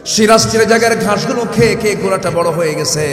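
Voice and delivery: high, loud fervent preaching; preaching at full force. Acted. Preacher urging the faithful, fervent shout. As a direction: preach it out to the crowd with fire.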